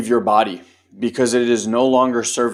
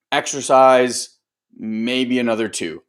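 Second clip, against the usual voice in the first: normal talking voice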